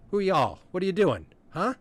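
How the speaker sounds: high, loud, demanding questions; suspicious small Corky. Acted. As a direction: challenge strangers in a high-pitched little voice. Corky challenges the strangers.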